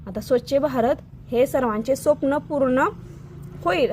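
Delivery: ordinary delivery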